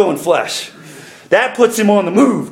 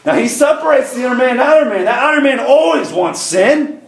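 high, emphatic pulpit declarations; full-force preaching; 5 semitones higher than usual. Real, not acted. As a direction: drive each phrase home to the congregation.

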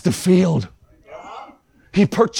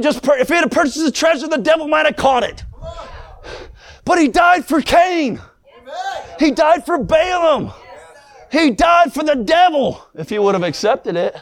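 full-force preaching; 7 semitones higher than usual. Acted.

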